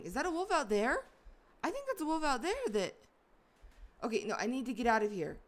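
real rising alarm. Real, not acted. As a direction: hurry the words out in alarm. Spots wolf while stuck.

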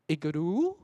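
loud questioning "igloo?", wrongly rising on the ending. Retold or imitated. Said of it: "一律拉高最后音节".

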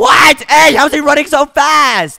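high, loud disbelief; astonished shout. Acted. Slow racer watching his rival speed past, astonished shout. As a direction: yell in amazement.

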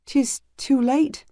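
slow, gentle regretful voice; softened with regret. Acted. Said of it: "His voice softened with regret".

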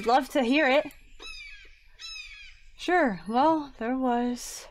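ordinary talk, nothing marked

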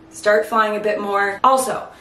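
usual speaking voice

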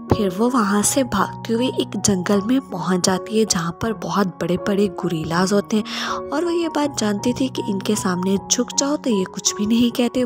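ordinary delivery

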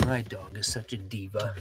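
soft aside. Real, not acted. Soft aside about dog.

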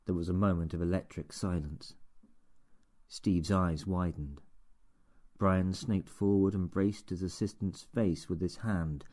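plain reading voice

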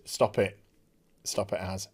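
low, soft real aside; quiet scolding. Real, not acted. Speaker breaking off to tell Az to stop, quiet scolding. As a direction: drop right down to tell off a nuisance.

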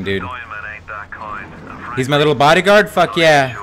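ordinary talk

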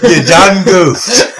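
meme voice. Pretend. Meme quote, laughing.

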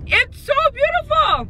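drawn-out, high delighted gush. Real, not acted. Showing viewers her new home, gushing delightedly. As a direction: swoon over how beautiful it is.

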